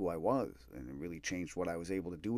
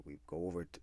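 normal talking voice

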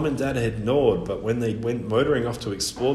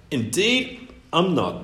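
high-pitched, offended sniff; sniffy; 5 semitones higher, slower than usual. A narrator's reading. Bird insulted at being called a parrot, sniffy. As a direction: say it haughtily, pitched up. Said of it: "the bird sniffed".

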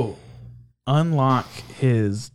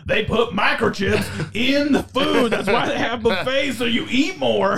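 Southern conspiracy theorist; 8 semitones higher than usual. Acted. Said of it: "conspiracy theorist voice is a Southern accent".

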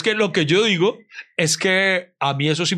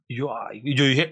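usual speaking voice

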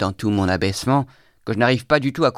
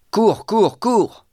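loud, much higher urgent cries; shouted command; 12 semitones higher, 5 dB louder, much slower than usual. A narrator's reading. Huntsmen order the naked boy to run, shouting. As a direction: yell it like huntsmen driving prey. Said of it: "lui crient les piqueurs".

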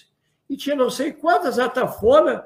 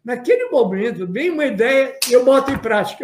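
normal talking voice

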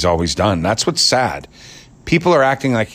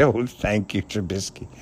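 softer, fading fawning thanks; grateful groveler; 7 dB quieter than usual. Retold or imitated. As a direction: sigh out the thanks, spent. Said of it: "swallow at the end and say".

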